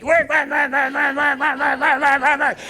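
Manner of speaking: high, rapid repeated nagging; hammed-up frantic chant; frantic urging. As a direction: badger a stuck machine in a high voice. Acts out urging a stuck computer.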